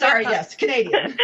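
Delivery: normal talking voice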